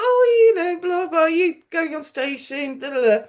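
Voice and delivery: high, flustered chit-chat voice; nervous small talk. Retold or imitated. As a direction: babble to fill the silence. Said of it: "So I was like".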